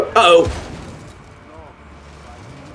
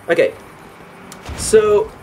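normal talking voice

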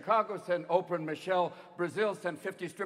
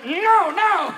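loud, high mock protest; hammed-up loud denial; 7 semitones higher, 7 dB louder, slower than usual. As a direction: wail the denial, then plead it. Acted old boss protesting loudly.

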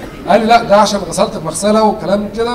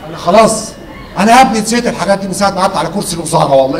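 normal talking voice

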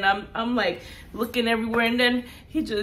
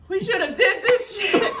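high, louder line through laughter; laughing quote; 10 semitones higher, 5 dB louder than usual. Retold or imitated. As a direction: crack up while quoting yourself. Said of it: "So that's where I'm like".

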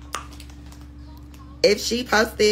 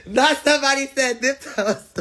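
incredulous laugh; 7 semitones higher than usual. Real, not acted. Repeating chat comment, laughing.

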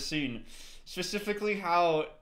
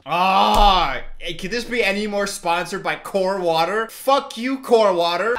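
loud, raised-voice outburst; angry; 11 dB louder, slower than usual. Real, not acted. Viewer fed up with the film's sponsor, angry shouting. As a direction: yell it in real exasperation. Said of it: "I'm actually fucking angry right now".

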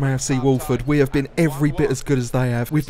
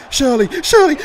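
shouting the name louder and higher; building shout; 13 semitones higher, 7 dB louder than usual. Real, not acted. Commentator urging his striker on, rising shout. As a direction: yell her on as she shoots. Player shooting at goal.

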